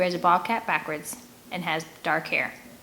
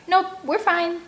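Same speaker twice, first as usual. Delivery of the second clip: much higher, louder overly cheerful call; shouting; 11 semitones higher, 4 dB louder, slower than usual. A narrator's reading. Boy shouting a fake all-clear across the river. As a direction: shout it too brightly. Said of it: "before shouting".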